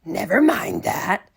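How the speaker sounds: loud breathy snap; snapped. Acted. Miss Swamp silencing the kids' question, loud breathy snap. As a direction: cut them off sharply. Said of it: "snapped Miss Swamp".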